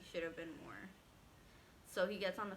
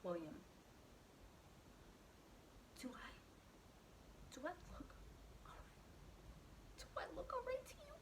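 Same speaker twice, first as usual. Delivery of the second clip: breathy whispered disbelief, pitched up; incredulous whisper; 10 semitones higher, 11 dB quieter, faster than usual. Retold or imitated. Answering the guard mid-contraction, in an incredulous whisper. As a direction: hiss it out in disbelief.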